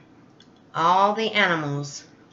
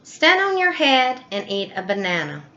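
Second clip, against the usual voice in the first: eager chimp voice; 6 semitones higher than usual. Acted. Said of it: "Chimp said".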